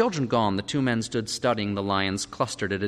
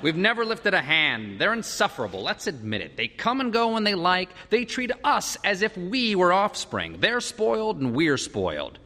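put-on high, emphatic venting about the children; indignant parental complaint; exasperated parent; 11 semitones higher than usual. A narrator's reading. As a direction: rant with pitch swooping up.

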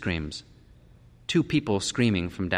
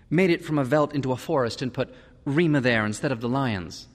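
lightly voiced spouse, pitched higher; voiced story character; 6 semitones higher, 3 dB louder than usual. A narrator's reading. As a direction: read as dialogue, lifted above the narration. Husband and wife talking at night.

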